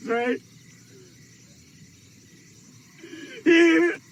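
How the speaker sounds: put-on tearful drunken cry; crying outburst. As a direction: wail it through sobs.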